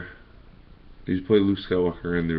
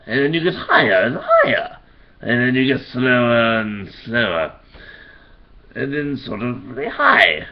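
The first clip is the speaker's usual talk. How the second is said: Joker voice demo; 3 semitones higher than usual. Shown as a demonstration. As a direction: stretch each word like a grinning madman, climbing then sinking. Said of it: "So this is how I do the Joker".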